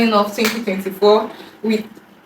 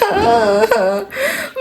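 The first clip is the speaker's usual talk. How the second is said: laughing; 9 semitones higher than usual. Real, not acted. Laughing over gift.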